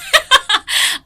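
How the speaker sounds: high loud laugh at her own joke; loud burst of laughter. Real, not acted. Teacher laughs at her own American impression, loud and high.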